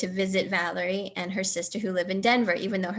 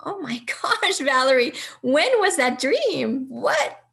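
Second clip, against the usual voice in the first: much higher, louder, slow amazed outcry; realizing the timing, overwhelmed; stunned cousin hears Tuesday dream, amazed outcry; stunned excitement; 5 semitones higher, 6 dB louder, slower than usual. Retold or imitated.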